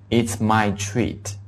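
It's my treat, very slowly and clearly; slow it right down, word by word; slow model sentence. Shown as a demonstration. Teacher modelling paying for dinner: slow phrase. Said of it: "可以说".

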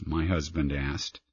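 ordinary delivery

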